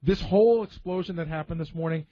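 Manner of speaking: ordinary talk, nothing marked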